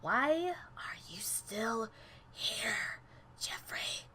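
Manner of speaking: slow, soft threatening whisper; menacing whisper. Acted. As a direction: breathe the question in his ear. Character menaces Jeffrey quietly.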